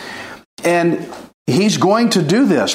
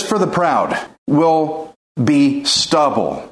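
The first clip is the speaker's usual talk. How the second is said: slow scripture reading; 2 semitones lower, slower than usual. Acted. Scripture read slowly.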